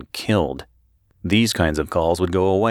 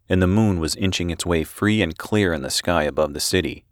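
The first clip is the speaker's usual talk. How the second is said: ordinary delivery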